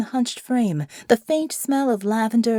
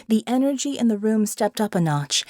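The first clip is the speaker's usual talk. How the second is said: ordinary delivery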